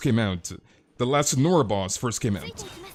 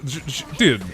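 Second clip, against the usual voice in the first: ordinary delivery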